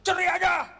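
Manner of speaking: high, loud angry outburst; mock threat. Angry husband threatening his wife with divorce, acted shout. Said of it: "dikit-dikit ngancam".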